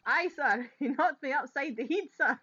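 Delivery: loud, high hammed-up Scots witness; joking broad Scottish officer; broad Scots accent. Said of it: "do this whole episode in a broad Scots accent".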